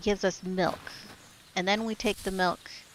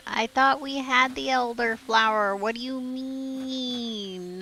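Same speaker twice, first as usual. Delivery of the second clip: higher, drawn-out complaint; frustrated whine; 4 semitones higher, 4 dB louder, slower than usual. Real, not acted. Player protesting that they had the elderflower, frustrated whine. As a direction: whine it out, stretching the last words long.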